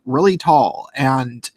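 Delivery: ordinary talk, nothing marked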